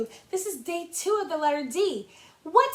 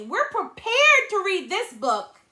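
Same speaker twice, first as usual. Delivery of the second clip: ordinary delivery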